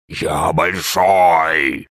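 very slow, hollow creature drawl; drawn-out monster voice. A narrator's reading. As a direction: stretch each word like a giant. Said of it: "завопило существо".

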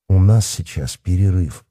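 ordinary delivery, nothing marked

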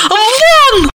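high, loud panicked scream; panicked yell. Acted. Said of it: "в панике завопила она". (панический визгливый крик)

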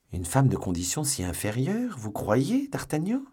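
high, doubting pout of contempt; sceptical, pouting scorn; scornful. A narrator's reading. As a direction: question it with disdain, pitch climbing sharply. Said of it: "fit Porthos en allongeant les lèvres avec mépris".

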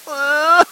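shrill laugh that sounds like crying; high laughing squeal. Acted. Laughing so high the host asks if she cries. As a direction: squeal out a laugh that passes for sobs. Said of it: "Vous pleurez?".

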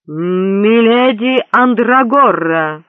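loud, very slow herald's call; quavering announcement. A narrator's reading. Herald announcing the bride, quavering. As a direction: proclaim the bride, stretching her name. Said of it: "объявил дрожащий голос глашатая".

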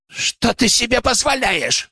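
high, slow, squealed outrage; indignant squeal. A narrator's reading. Baron grabs his sabre at the insult, squealing. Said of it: "взвизгнул барончик".

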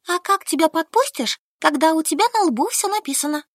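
выше, с ехидным упрёком; поддеть подругу; колко. A narrator's reading. Ссора двух подруг. (колкий упрёк)

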